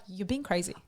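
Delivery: soft, restrained self-reproach; voice of reason. Acted. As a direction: quietly talk yourself down, with a pause. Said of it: "there's a person inside me that's like".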